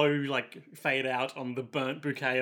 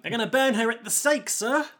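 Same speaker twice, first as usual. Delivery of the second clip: high-pitched Cockney urchin; piping Cockney voice, rising at the end; Cockney orphan; 7 semitones higher than usual. Acted. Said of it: "more of a Cockney orphan".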